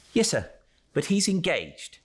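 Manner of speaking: high, quick footboy voice, a little defiant; a cheeky young clerk answering the door; sharp lad. Acted.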